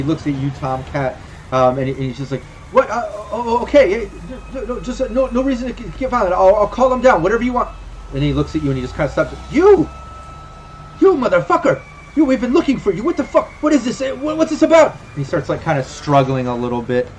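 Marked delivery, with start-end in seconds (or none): 2.7-7.7 high, stammering appeasement; acted panicky surrender in a raised pitch; flustered backing down. Game master voices man giving in. 9.5-14.9 high-pitched, agitated accusation; acted panicky indignation, pitched way up; outraged recognition. Character suddenly recognizes player, outburst.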